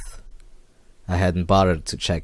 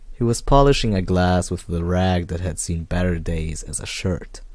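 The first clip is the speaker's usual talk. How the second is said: ordinary delivery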